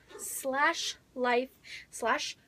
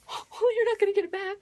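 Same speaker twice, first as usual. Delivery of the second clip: high, louder mock shock after a gasp; mock gasping surprise; 7 semitones higher, 4 dB louder than usual. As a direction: gasp and squeak it in fake alarm. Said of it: "And I was like".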